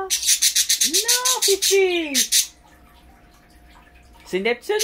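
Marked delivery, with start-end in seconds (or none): 0.0-2.5 high sing-song scolding of the pet; pet talk. Real, not acted. Talking to her pet Fifi, high pet voice. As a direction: gently tell the pet no, pitched way up.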